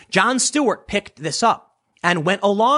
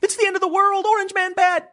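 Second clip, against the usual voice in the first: much higher, hammed-up doom voice; mock paranoid panic; 13 semitones higher than usual. Mocking paranoid viewers who fear the end, high hammed-up panic. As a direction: wail it like the sky is falling. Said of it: "they're paranoid and delusional".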